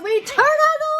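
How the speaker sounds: high, loud reveal; playful reveal. Pretend. Parent revealing book surprise, playful excitement. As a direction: build to the surprise.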